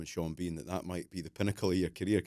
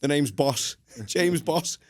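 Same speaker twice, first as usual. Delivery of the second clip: mock Bond; 7 semitones higher, 9 dB louder, much slower than usual. Host parodies Bond catchphrase, laughing.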